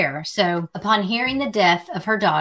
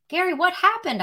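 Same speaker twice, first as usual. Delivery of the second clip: sharp frightened question, pitch way up; mild mock frightened snap; 12 semitones higher than usual. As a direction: snap it out in fear, voice jumping high. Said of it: "I snapped, frightened now".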